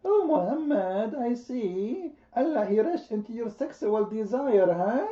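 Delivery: high, slow mocking woman's voice; women voice. Aisha mocking Muhammad in a high, slow woman's voice. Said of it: "in the women voice".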